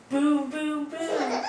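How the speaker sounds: big loud booms; loud booming. Acted. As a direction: boom it out loudly. Sound book, booming again, laughing.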